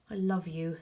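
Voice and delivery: usual speaking voice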